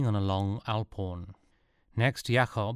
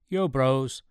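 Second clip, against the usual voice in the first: plain reading voice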